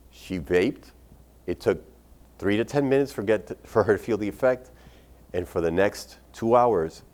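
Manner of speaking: ordinary talk, nothing marked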